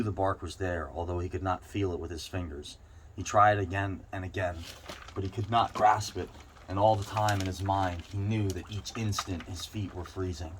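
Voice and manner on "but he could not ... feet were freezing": plain reading voice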